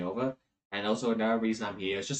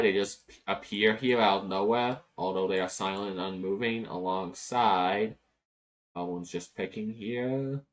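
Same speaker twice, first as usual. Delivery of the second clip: usual speaking voice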